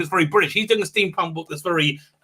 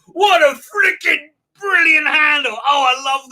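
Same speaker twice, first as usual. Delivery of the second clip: delighted shout; 8 semitones higher, 5 dB louder than usual. Real, not acted. Delighted by a viewer's handle.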